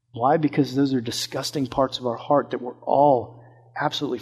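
low, soft sermon voice, drawn in close; quiet intimate sermon tone; hushed preaching. Acted. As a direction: preach it softly, like a confidence.

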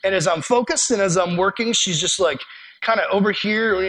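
normal talking voice

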